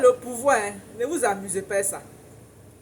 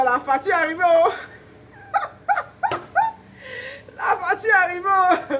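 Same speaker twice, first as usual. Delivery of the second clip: mock wailing; 8 semitones higher than usual. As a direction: wail it like a fake sob. Mock panic over the bill. (lamentation exagérée pour rire, aiguë et forte)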